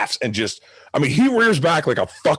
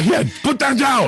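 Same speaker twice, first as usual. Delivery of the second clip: high-pitched, raised-voice command; mock parental order; 12 semitones higher than usual. Parent telling child to put it down, alarmed. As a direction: play a parent sharply ordering a kid.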